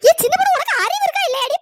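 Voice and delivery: loud, high telling-off; exasperated loud scolding; scolding shout. Acted. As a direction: yell at her for being foolish. Scolding the girl in alarm.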